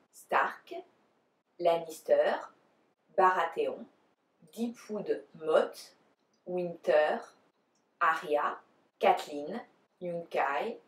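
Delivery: names pronounced in a French accent. Shown as a demonstration. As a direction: say each name the French way. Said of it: "try to do it the French way".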